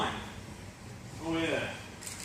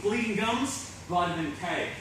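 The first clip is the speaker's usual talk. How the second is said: ordinary talk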